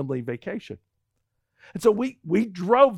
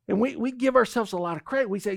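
ordinary talk, nothing marked

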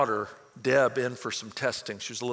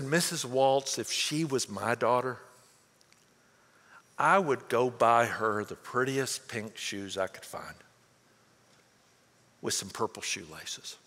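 gentle doctor; 5 semitones lower than usual. Retold or imitated. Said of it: "the way he said it".

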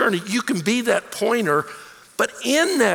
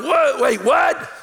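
high, loud startled what; mock disbelief of the disciples; shocked what; 6 semitones higher, 3 dB louder, much slower than usual. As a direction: blurt it out as he lifts off. Disciples see Jesus rise up.

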